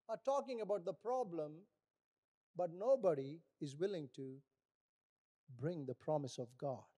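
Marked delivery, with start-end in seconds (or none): none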